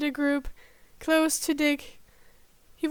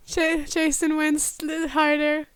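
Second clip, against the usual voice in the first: usual speaking voice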